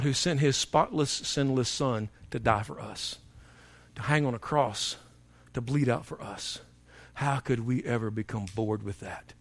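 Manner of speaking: soft, low, reverent voice; quiet, intimate pulpit appeal; hushed preaching. Real, not acted. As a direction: bring it down to solemn wonder.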